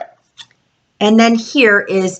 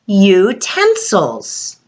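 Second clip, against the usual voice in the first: "Utensils" spoken slowly with the long yoo stretched; slow, drawn-out word; much slower than usual. Shown as a demonstration. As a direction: draw out the opening yoo of "Utensils". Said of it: "with the sound U again".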